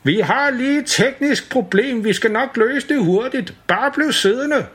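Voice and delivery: high, slow call up to the stuck riders; calling from far below. Acted. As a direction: shout it up from the ground. Operator calls up from below.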